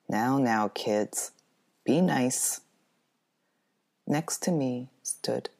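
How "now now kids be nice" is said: ordinary delivery, nothing marked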